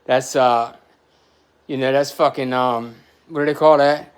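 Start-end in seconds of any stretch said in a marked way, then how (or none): none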